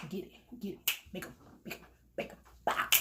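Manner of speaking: breathy whisper to herself; hushed get-it chant; whispered hype. Real, not acted. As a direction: hype yourself up under your breath.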